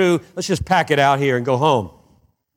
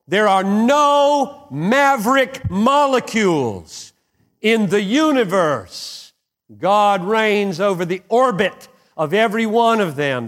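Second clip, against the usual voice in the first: fervent preaching; 6 semitones higher than usual. Acted. Preaching at full force.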